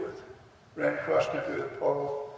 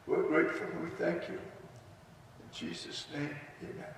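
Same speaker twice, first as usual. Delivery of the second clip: prayer. Real, not acted. Pastor praying at a memorial ceremony, reverent. Prays for veterans.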